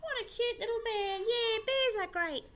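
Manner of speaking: high, fast cooing voice; cooing tourist. Acted. Tourists gushing at the koala, high and quick. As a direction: gush over the cute bear.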